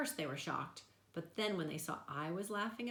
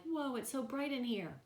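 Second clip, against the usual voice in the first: amazed; 8 semitones higher than usual. A narrator's reading. Sudden amazement at brightness.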